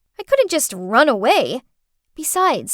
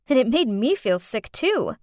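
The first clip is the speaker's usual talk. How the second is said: plain reading voice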